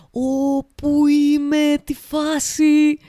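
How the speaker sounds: high, slow, bewildered mock confusion; a student baffled by a teacher's posh British accent, drawn out high; dazed lost student. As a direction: act like you've just walked into the wrong world. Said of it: "και εσύ είσαι".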